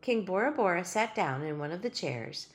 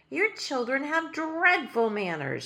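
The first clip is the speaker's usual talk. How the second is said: disapproving; 7 semitones higher, 5 dB louder, slower than usual. Acted. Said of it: "looked at them disapprovingly".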